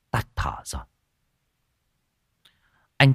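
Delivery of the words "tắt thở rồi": whispered grim news; hushed. Acted. Villager telling the son his father died, whispered. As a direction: drop to a whisper on the death.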